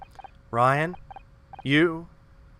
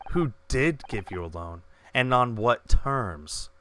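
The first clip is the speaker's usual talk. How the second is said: normal talking voice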